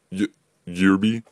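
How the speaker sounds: nervous stutter on the name. Acted. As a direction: trip over the first sound nervously. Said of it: "stutters the boy".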